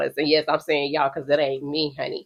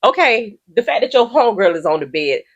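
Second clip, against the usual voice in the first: normal talking voice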